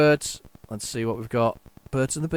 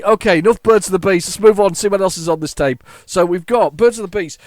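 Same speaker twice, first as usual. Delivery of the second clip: ordinary talk, nothing marked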